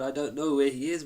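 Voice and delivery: loud, showy denial; loud pretend ignorance. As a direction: call it out so the whole garden hears. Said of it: "saying it out loud".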